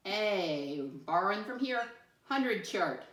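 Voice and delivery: ordinary talk, nothing marked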